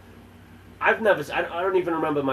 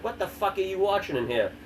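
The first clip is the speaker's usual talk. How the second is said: higher-pitched baffled demand; incredulous demand; 7 semitones higher than usual. Retold or imitated. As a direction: retell a stunned reaction, voice pitched up. His reaction retold.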